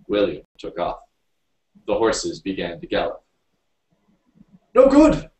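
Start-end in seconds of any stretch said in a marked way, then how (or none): 4.7-5.3 very high, loud shriek; panicked shriek. A narrator's reading. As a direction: shriek in alarm at a reckless driver. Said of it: "shrieked the driver".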